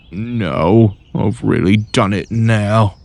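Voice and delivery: slow, sorry wheeze; wheezing sadly. A narrator's reading. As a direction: sigh it out in defeat. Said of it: "he wheezed sadly".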